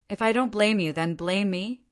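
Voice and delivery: ordinary delivery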